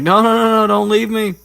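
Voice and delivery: very high, loud frantic begging; mock panicked plea. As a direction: beg in a hammed-up squeal. Neville begging not to be left.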